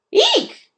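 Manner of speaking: very high startled eek; frightened mouse squeak. A narrator's reading. Ralph squeaking face to face with the gopher. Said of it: "squeaked Ralph".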